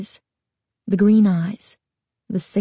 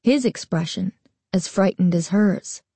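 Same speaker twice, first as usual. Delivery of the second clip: ordinary delivery, nothing marked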